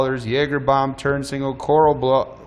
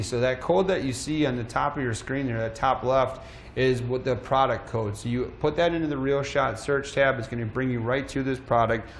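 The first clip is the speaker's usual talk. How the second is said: ordinary delivery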